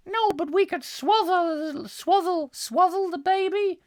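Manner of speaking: very high prim wife voice; shrill it up as the wife. Acted. Wife answering husband about America, very high voice.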